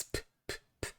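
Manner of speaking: "p" as bare soft puffs without voice; voiceless p sound. Shown as a demonstration. Teacher showing the voiceless counterpart of B, unvoiced puffs. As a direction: pop "p" quietly with no vowel. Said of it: "pronounced with the voiceless counterpart".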